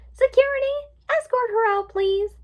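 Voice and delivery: high, loud imperious call; flamboyant diva. A narrator's reading. Rainbow Dash calling security on Twilight, diva command. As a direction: summon help in a theatrical high voice.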